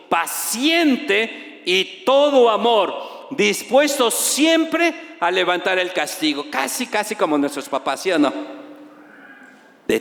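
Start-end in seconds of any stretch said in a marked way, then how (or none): 0.1-6.5 slow emphatic reading. Real, not acted. Rereads verse slowly.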